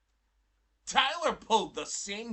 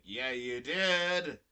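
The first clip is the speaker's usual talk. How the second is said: very slow, stretched insistence; slow, emphatic retold retort; drawn-out contradiction; 6 semitones lower, much slower than usual. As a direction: drag out each word to insist.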